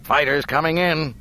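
high, loud pilot on radio; clipped pilot call under fire; terse pilot radio under strain. Acted. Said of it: "fighter pilot's voice came back over the radio".

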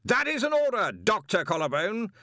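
high, loud firm command; loud order. A narrator's reading. Arch-Chancellor commands the wizard to continue, loud. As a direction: order him with full authority.

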